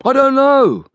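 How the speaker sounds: a high, loud desperate cry; panicked outburst at the top of the voice. A narrator's reading. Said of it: "cried Liggett".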